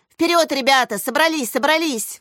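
rallying exclamation. A narrator's reading. Makar leading his team out, exclaiming. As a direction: rouse the team with quick cries. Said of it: "воскликнул Макар".

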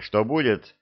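ordinary delivery

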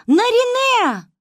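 high, loud drawn-out call of the name; distant desperate call. A narrator's reading. A voice calls the girl from far away in the forest, a high, loud call. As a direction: call out across the dark forest. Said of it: "захлёбываясь криком".

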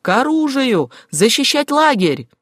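rally everyone with a high shout; rallying cry. A narrator's reading. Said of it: "его крик прокатился по острову". (крик «к бою», как набат)

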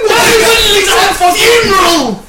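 very high, drawn-out loud yell; exasperated shout. Pretend. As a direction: stretch the question into a high holler. Host shouts funeral prompt at actor.

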